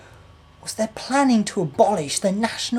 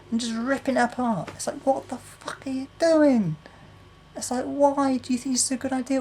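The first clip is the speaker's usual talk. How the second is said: outraged shout; 8 semitones higher than usual. Real, not acted. Vlogger yelling at the government over the NHS, outraged. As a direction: demand an answer in outrage.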